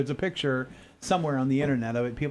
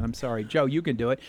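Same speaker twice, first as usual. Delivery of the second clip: ordinary talk, nothing marked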